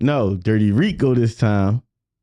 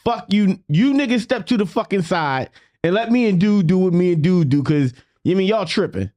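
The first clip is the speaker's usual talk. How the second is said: higher, heated telling-off; retold anger; 8 semitones higher than usual. The speaker tells the crowd to step aside, higher and heated.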